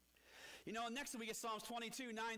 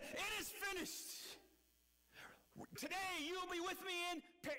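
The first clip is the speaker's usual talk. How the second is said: crying out; 8 semitones higher than usual. Shown as a demonstration. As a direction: cry "finished" out with all remaining strength. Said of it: "he cried out with all of his might".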